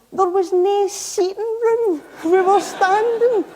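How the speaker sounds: giggling as she recalls the crowded chamber; amused laugh breaking through the line. Real, not acted. Laughing while recalling packed chamber.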